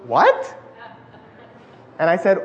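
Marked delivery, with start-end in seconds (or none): 0.0-0.5 higher, mock-puzzled one-word reaction; retold baffled boy. Little boy baffled by the fast talker, puzzled. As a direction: lightly imitate a bewildered child. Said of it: "he was like".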